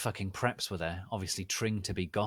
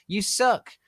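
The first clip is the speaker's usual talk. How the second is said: high shriek; 13 semitones higher, 9 dB louder than usual. Acted. Author insulting the critics.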